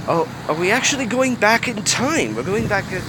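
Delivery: delighted reader breaking off the story; excited aside. Real, not acted. Reader to listeners, surprised and excited by the flashback.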